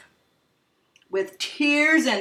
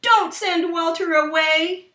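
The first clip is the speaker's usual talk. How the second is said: begging; 8 semitones higher, 3 dB louder than usual. Acted. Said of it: "begged Betty and Billy".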